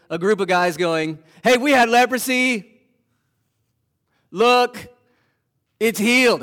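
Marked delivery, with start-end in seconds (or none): 1.4-6.4 a louder, higher boast of the cure; excited healed lepers. Acted. Healed lepers showing the priests, loud and excited. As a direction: show it off, can't contain it.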